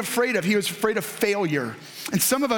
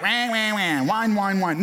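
mock whining. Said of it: "And I was like".

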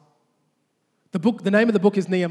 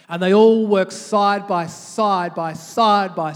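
side by side slowly punched, each side louder; emphatic preaching; 5 dB louder, much slower than usual. Acted. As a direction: drive home side by side, slowly. Preacher stressing teamwork.

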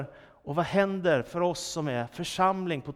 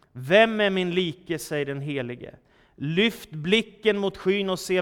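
solemn, louder Bible reading; scripture reading; 3 semitones higher, 4 dB louder than usual. Acted. Preacher reading Isaiah to the church, solemn and full. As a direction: read the prophet's words with grave weight. Reads Isaiah aloud to congregation.

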